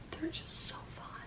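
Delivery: gushing whisper; softly whispered crush confession. Real, not acted. Gushing aside about crushes.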